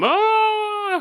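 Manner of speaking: high drawn-out "oh"; comic wail at being drenched; mock cry of dismay. Acts Tom getting sprayed.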